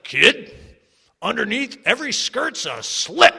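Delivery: put-on loud gruff old-timer; hammed-up cranky old-timer. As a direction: lay down wisdom like a crusty old-timer. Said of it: "they'd say something like".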